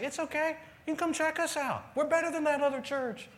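high, singled-out boastful voice; over-eager pitch. Acted. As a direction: push the invitation in a high, comic voice. Acted over-eager church invitation, boasting.

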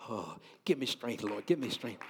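praying for patience through teeth. Real, not acted. Exasperated preacher praying under breath.

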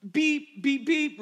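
bleeping out curse words with a loud high beep; beeping out the swear words; mock censor beeps. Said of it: "other chosen words that I can't say".

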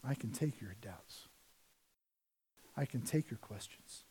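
soft, low, gentle reassurance; calm a frightened child; God gently answering the doubter; reassuring God. Pretend. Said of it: "And God says".

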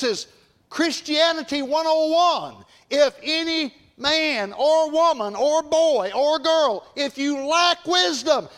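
high, slow, ringing pulpit cry; full-force preaching. Real, not acted. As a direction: proclaim it with force, drawing out each key word.

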